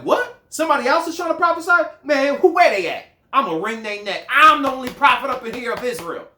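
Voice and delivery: high, loud, fast indignant rant; mock outraged Moses. Preacher imagining Moses enraged at rival prophets, outraged. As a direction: act the jealous prophet he wasn't. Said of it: "his attitude was like".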